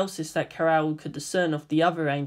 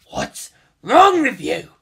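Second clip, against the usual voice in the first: angry; 6 semitones higher, 8 dB louder, slower than usual. Acted. Kaji still angry.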